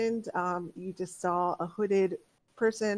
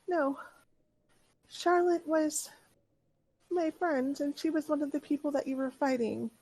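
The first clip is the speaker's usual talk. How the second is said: put-on high-pitched child's voice, measured pauses; little girl; 7 semitones higher than usual. Little girl explaining who her friend was, high childlike voice. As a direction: speak as a small girl, pitched up. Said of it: "And she says".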